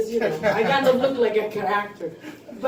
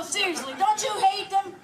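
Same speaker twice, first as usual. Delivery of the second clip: ordinary talk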